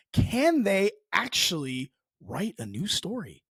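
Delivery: slow, with "can" and "actually" punched high; emphatic challenge. Real, not acted. Podcaster doubting Disney can write something new, slow emphatic challenge. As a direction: spell out "can they actually" slowly, word by word.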